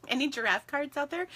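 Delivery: ordinary talk, nothing marked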